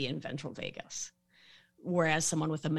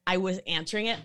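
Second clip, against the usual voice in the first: normal talking voice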